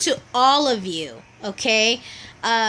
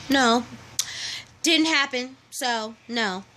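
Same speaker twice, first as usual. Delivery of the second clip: ordinary talk, nothing marked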